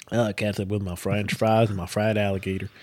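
lightly drawn Southern US twang, teasing; a hint of a Southern American accent poking fun; mock Southern accent. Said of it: "if you have a fucking southern accent".

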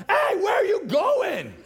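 high, slow, loud jeering shout; crabs in a bucket. Retold or imitated. Crabs yelling at one escaping the bucket, high slow shout. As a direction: yell it up high at the crab escaping. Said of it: "they're all like".

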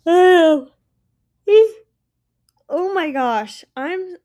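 loud, high yawned oh; late-evening yawn. Real, not acted. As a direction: let a yawn take over. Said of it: "I must be really tired".

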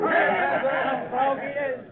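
booming villain laugh; hearty pirate laugh. Acted. Pirate laughing after boast.

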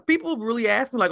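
ordinary talk, nothing marked

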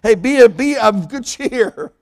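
Jesus calling out to the disciples over the waves; called-out encouragement. Retold or imitated. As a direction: shout cheer to the frightened disciples. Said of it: "he yells to them".

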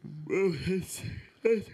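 talking through a yawn; words stretched by a yawn. Real, not acted. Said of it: "See, now he's yawning".

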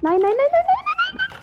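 rising panicked nos; scared horror game streamer. Real, not acted. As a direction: panic as it closes in. Panicked streamer under attack.

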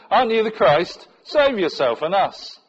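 loud, high taunting challenge from a criminal; hurled insult. A narrator's reading. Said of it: "hurled insults at him".

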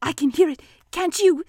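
nervously seek agreement; anxious. Acted. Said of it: "said Piglet anxiously".

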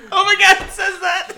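high, loud disbelief; excited. Real, not acted. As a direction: burst out in amazed laughter. Disbelieving laugh at the notes.

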